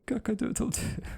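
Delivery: high crowing call; rooster crow. Acted. Rooster crows after refusing squeak.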